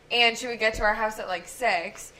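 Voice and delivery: usual speaking voice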